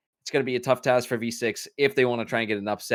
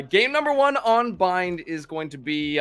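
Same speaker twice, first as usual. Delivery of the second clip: ordinary talk, nothing marked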